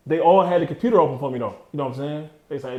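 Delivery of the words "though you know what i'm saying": normal talking voice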